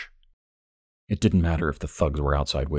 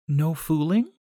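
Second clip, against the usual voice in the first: much higher, slow, gentle woman's voice; young woman June; 10 semitones higher, slower than usual. A narrator's reading. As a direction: voice June high and dreamy.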